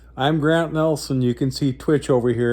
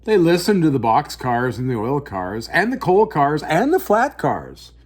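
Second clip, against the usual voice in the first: story narration; 4 semitones higher than usual. A narrator's reading. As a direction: tell it like a bedtime picture book. Reads picture book aloud.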